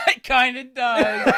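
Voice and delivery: slow, laughing through words. Real, not acted. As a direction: chuckle while admitting it. Laughing at comparison.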